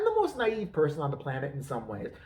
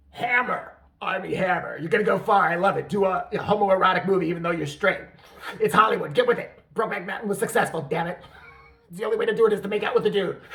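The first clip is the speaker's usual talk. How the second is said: loud, punchy sales-pitch voice; hammed-up showbiz agent, loud and insistent; pushy Hollywood agent; 5 dB louder than usual. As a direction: sell it hard like an excited agent. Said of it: "some agent actually go".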